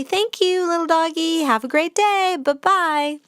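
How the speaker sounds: high, sing-song farewell to a dog; friendly dog goodbye. Shown as a demonstration. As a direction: let the dog go with a cheerful bye-bye. Politely leaving the shy dog.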